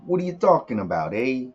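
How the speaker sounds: hammed-up New York accent to open the video. Opening a music review in his put-on New York accent. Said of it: "so this is my New York accent".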